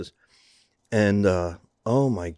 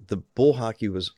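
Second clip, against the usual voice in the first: normal talking voice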